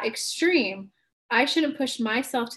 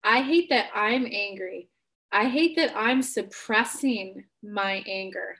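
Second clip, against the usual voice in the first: slow, deliberate reframed confession; slow coaching demo of the flipped line; slow example statement; slower than usual. Shown as a demonstration. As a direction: model the new sentence slowly. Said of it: "yours will look something like".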